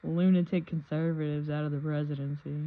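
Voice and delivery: loud, hammed-up political rant; mock rant. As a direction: rant it like an outraged activist.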